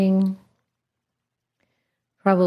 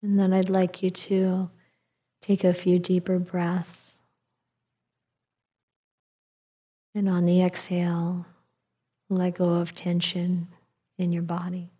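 calm meditative tone. Acted. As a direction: ease them into letting go. Guides breathing in meditation.

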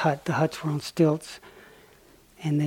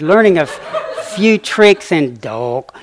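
laughing; 2 semitones higher, 7 dB louder, slower than usual. Real, not acted.

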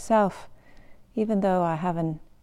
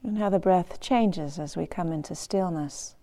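unhurried meditation guide, long pauses between phrases; guided meditation. Acted. Teacher guiding meditators to feel the breath, guided meditation voice. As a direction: guide them gently into stillness, phrase by phrase.

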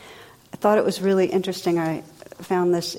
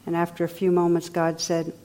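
normal talking voice

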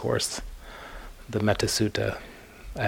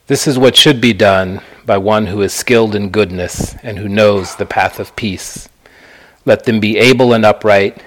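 louder, measured reading with pauses between phrases; scripture recitation; 7 dB louder than usual. Acted. Teacher reads the Buddha's words to a meditation group, measured recitation. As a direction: recite a sacred text phrase by phrase, projected. Reads the Metta Sutta aloud.

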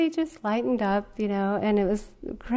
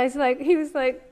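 ordinary delivery

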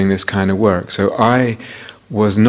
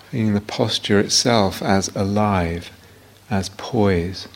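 guided meditation. Real, not acted. Teacher leading students into body awareness, guided meditation voice. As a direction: guide them unhurriedly, leaving silence between phrases.

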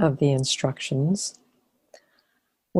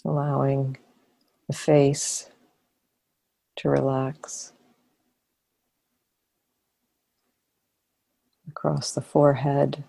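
calm, unhurried body-scan voice with long pauses; guided meditation. Real, not acted. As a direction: ease them into relaxing, phrase by phrase. Leads a body-scan relaxation.

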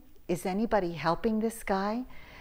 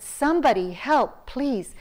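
mild mock call for help, louder and higher; raised call for help, pitched up; 5 semitones higher, 6 dB louder than usual. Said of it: "I called for help".

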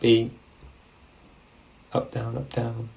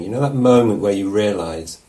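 ordinary talk, nothing marked

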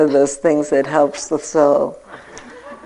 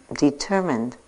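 normal talking voice

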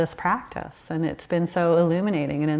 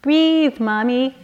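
put-on high, little-child voice; imitating a toddler; 6 semitones higher, 4 dB louder, slower than usual. Two-year-old daughter calming her angry mother, high toddler voice. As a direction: coax Mommy like a tiny child would. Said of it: "My little two-year-old goes".

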